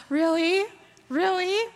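loud high rising disbelief; incredulous. Retold or imitated. Disbelief at God's message.

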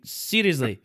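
normal talking voice

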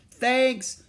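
high, loud call after someone. A narrator's reading. Jack calling thanks to the departing kangaroo, loud high call. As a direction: call it out to someone bounding away. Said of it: "called Jack".

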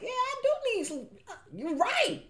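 high, excited answering the Lord; reply to the Lord, surprised and laughing; talking back to God. Retold or imitated. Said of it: "he was telling me some stuff".

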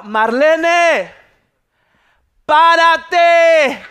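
loud, high, drawn-out command; dad shouting. Acted. Dad yelling to floundering wife, acted shout. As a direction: bellow it across the pool. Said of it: "le dice mi papá".

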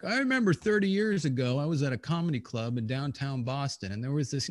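lightly voiced nostalgic stranger; old-timer reminiscing. Mock old guy telling a comedy club story. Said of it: "is some guy gonna be like".

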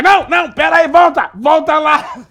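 high, loud, laughing demand; laughing shout. Retold or imitated. Father yelling with laughter for the segment to come back. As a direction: yell it through laughter like a delighted father. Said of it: "aí o papai".

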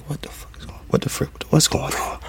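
stunned, whispered under his breath; hushed whisper of disbelief at the jersey; shocked whisper. Retold or imitated. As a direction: whisper it like you just can't believe it. Shocked discovery retold.

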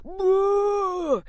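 high straining whoa; showing off a gym lifting yell; weightlifting noise. Shown as a demonstration. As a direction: grunt it out like lifting a heavy bar. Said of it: "that's my weightlifting noise".

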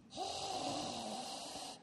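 long airy whoosh; tumbleweed wind noise. Retold or imitated. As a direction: imitate wind blowing through an empty room. Imitates tumbleweed blowing through silent room.

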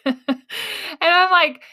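real slow laugh rising high. Real, not acted. Podcaster cracking up at her own story. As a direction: let the laugh take over the words. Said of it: "I am laughing at myself".

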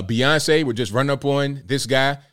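usual speaking voice

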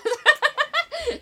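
loud giggle fit; high burst of laughter. Real, not acted. Laughing mid sentence.

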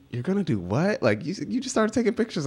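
retold high-pitched disbelief; mock incredulous question; incredulous dad. Dad reacts to quitting news.

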